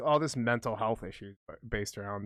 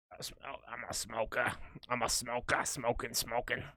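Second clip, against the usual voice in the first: lightly put-on smoker's voice; 5 dB quieter than usual. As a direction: slip into a mock smoker's voice. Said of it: "that goes down to the".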